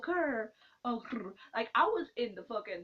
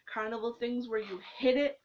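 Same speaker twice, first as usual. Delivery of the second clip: ordinary delivery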